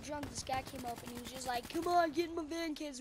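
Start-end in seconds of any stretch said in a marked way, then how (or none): 1.7-3.0 loud, higher beckoning call; pushy mock invitation; imitated stranger luring. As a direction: mimic a drunk-crowd creep calling kids over. Imitates stranger luring kids into van.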